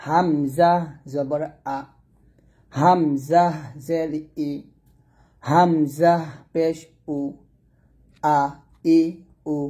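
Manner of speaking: slow হামজা with pauses before each vowel; measured model recitation of আ, ই, উ; spelling-out drill. Shown as a demonstration. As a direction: stretch হামজা, then give each vowel separately. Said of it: "এরকমভাবে পড়বেন".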